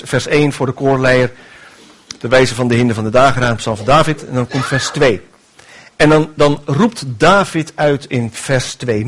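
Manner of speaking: usual speaking voice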